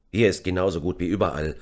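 plain reading voice

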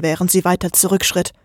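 plain reading voice

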